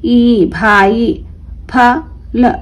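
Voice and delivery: भा then ई, split apart, then joined into भाई; syllable by syllable, then the whole word; half by half. Shown as a demonstration. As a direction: sound it out in halves. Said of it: "आधा आधा करके पढ़ेंगे इस तरीके से".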